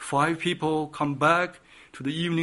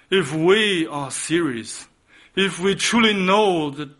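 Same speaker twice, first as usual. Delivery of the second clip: ordinary delivery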